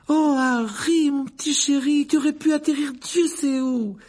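very high, slow-starting motherly wail; anxious mother, fussing. Acted. Mrs Weasley reaches Harry at last, anxiously fussing. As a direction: fuss over the child with relief. Frantic motherly greeting after running up.

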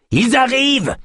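panicked repeat, near scream; frantic alarm. Acted. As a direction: shriek it as they come. Yosp repeats warning, more frantic.

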